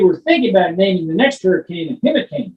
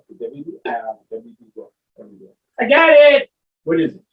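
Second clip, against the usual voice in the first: normal talking voice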